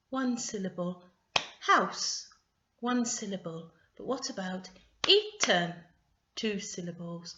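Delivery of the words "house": HOUSE higher, falling on one beat; one clapped beat. Shown as a demonstration. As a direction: punch HOUSE as a single syllable. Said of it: "I'm going to clap as I say the word".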